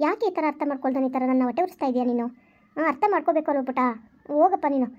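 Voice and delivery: put-on calm a crying child gently; mother consoling her weeping daughter; soothing mother.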